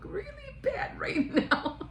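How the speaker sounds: high embarrassed words broken by laughter; giggling through mock dismay; laughing. The boss groaning that he looks bad, laughing.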